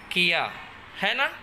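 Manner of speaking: ordinary talk, nothing marked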